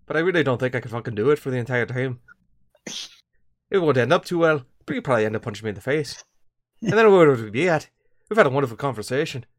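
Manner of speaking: tipsy, slurring Irish-Scottish brogue; comic Irish-Scottish brawler; parodied Irish-Scottish accent. Acted. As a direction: do a rambling mock Irish-Scottish accent, working up to a fight. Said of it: "the Irish Scottish accent".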